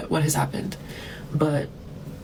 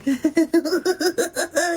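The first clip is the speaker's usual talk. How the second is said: high cackle; 13 semitones higher than usual. Real, not acted. Vlogger gloating over the troll, high gleeful cackle.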